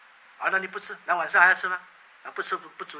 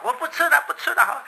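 high, loud stubborn refusal; defiant child; 9 semitones higher, 7 dB louder than usual. Acted. Child loudly refusing his mother's pleading. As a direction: snap it back like a sulking kid.

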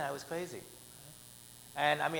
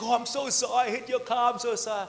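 higher, louder, flustered sorry; nervous apology; 7 semitones higher, 5 dB louder than usual. Retold or imitated. Guy who rear-ended him, apologizing in a higher, louder voice. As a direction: play the shaken driver begging pardon.

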